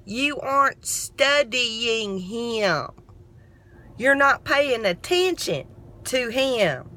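slow, patient spelling out of the meaning; slow explaining. Retold or imitated. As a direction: explain it slowly, word by word. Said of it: "she got it out".

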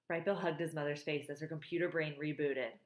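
plain reading voice

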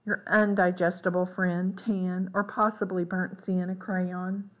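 ordinary delivery

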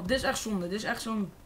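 normal talking voice

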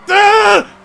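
sudden high, loud scream; panicked scream. Real, not acted. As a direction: scream out in alarm. Scream during the chase.

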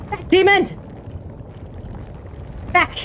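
high, sharp command to a demon; mock warding cry.

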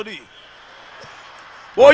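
ordinary talk, nothing marked

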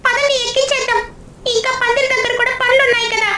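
put-on high, bright-eyed little kid voice; child voice. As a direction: speak like an eager small child. Character urges loading idol. (చిన్న పిల్లాడి గొంతు)

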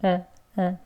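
quiet, short 으 sound; barely part the lips for 으; short schwa. Shown as a demonstration. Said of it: "어라고 냅니다".

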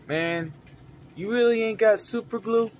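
customer voice. Retold or imitated. Customer asking again, voiced.

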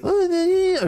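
very high drawn-out squeal; mock high-pitched whine. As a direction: squeal in mock reluctance at the bill. Réaction jouée au prix à payer. (petit couinement aigu, faussement réticent)

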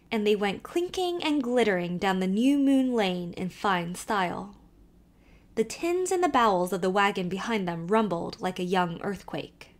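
ordinary delivery

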